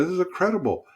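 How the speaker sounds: high-pitched retold amazement; amazed. Pastor to man being baptized, amazed. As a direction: relive being thrilled by the news.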